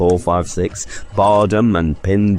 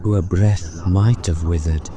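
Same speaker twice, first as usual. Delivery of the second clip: ghost's voice; 2 semitones higher than usual. Acted. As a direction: speak as a hushed solemn ghost. Ghost speaks of Scrooge's sister.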